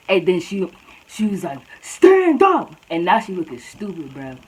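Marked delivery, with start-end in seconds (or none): 1.8-2.7 high, loud squawked command; mock angry yell. Tiny girl confronts taller student, high mock yell. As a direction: imitate a tiny girl yelling, pitched way up. Small girl orders her to stand.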